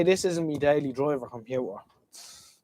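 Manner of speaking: ordinary talk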